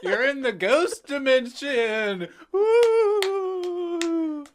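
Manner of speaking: high wailing ghost woo; spooky ghost wail. Pretend. Friend playing ghost.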